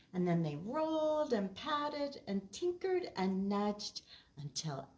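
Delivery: lively storytime read with character voices; reading to children. Acted. Librarian reads Oliver's Tree to children, lively and bright. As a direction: read it out to a room of kids.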